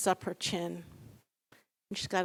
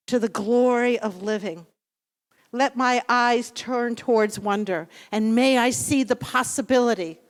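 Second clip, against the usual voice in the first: prayer; 2 semitones higher, 6 dB louder than usual. Acted. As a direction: intone it like a blessing over the congregation. Leads a closing prayer.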